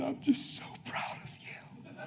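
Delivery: tearful whisper of a father; softly whispered, a father fighting tears. Retold or imitated. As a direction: breathe it like a choked-up dad. Dad's tearful words retold.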